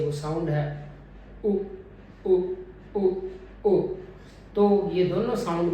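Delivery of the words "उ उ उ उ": short rounded उ sound, repeated for the class; vowel demo. Shown as a demonstration. Teacher models उ for the class. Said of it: "इस तरह से articulators को move करने से".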